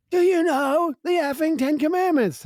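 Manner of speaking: hammed-up drunk woman yelling in his face. Drunk waitress confronting the former pastor, high yell. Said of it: "she turns around and she's like".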